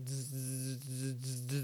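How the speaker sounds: soft electric "zzz" buzzes; buzzing lightning noise. Retold or imitated. Teller imitates lightning around the glasses, softly buzzing. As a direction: buzz quietly in short crackling bursts.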